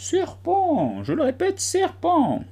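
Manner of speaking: very high, level insistent bird voice; calmer pigeon voice. A narrator's reading. Pigeon repeating its accusation, high and calmer. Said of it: "d'une voix plus calme".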